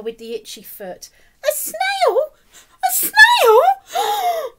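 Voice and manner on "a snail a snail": very high, loud shriek of alarm; teacher horrified at the snail; shocked shriek. A narrator's reading. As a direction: squeal as if a snail is in class.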